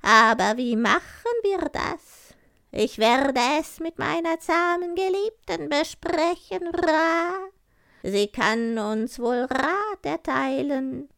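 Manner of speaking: high, slow crow voice with loud caws; raspy cawing crow. Acted. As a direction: croak it proudly like a talking crow. Crow speaks in the tale.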